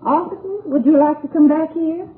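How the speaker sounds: high, lilting summons; calling out politely. Acted. Wife asking officers to come back, calling out. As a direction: call them over in a high voice.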